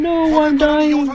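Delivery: high, slow whiny dying creature; dying Defiler voice. Retold or imitated. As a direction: whimper like a dying bug. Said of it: "Defiler saying".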